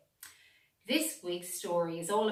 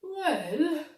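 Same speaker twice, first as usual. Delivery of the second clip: high loud drawn-out well; the dog starting to answer; little dog voice; 8 semitones higher, 8 dB louder than usual. A narrator's reading. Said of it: "Ursa Bingy".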